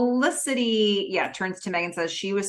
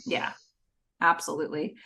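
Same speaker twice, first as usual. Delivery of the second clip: ordinary delivery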